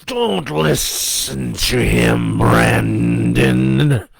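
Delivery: loud, slow, drawn-out demonic cry; devil crying out. A narrator's reading. Devil urges the boy not to listen, crying out. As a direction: howl a command as a devil. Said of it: "the devil cried".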